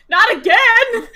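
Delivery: high, loud cry of dismay; startled yell. Acted. Nyla waking with a start, loud dismayed yell. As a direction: yelp it as she jolts awake. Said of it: "and she goes".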